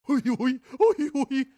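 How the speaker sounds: high, loud imitation of silly giggling; mocking giggle. Streamer ridiculing a man who giggled along, mocking high giggle. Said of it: "giggling like a little bitch".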